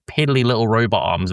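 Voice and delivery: usual speaking voice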